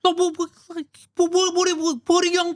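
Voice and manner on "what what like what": usual speaking voice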